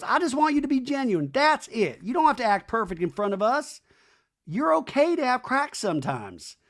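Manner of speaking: hammed-up exasperated appeal; mock pleading. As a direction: plead it in an imitated upset voice. Imitating Brittany's upset talking head.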